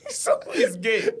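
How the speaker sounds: high, crying-laugh bewildered question. Retold or imitated. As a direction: ask it truly baffled. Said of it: "such a bewildered".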